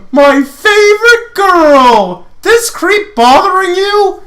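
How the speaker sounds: very high, loud, slow sing-song flirt. Acted. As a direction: ham up a cocky flirt. Lance voiced, flirty and loud.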